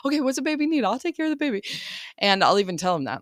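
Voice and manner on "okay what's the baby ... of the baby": put-on high, eager kid voice, much higher; eager kids imitated. Older kids offering to take the baby, high eager imitation. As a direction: play the big kids rushing to help, bright and eager. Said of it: "So everybody's like".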